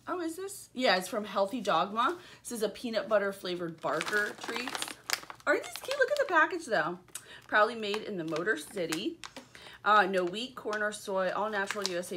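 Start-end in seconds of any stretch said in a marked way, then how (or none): 5.5-7.0 high, delighted gush; excited. Real, not acted. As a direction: squeal over something cute. Gushing over cute packaging.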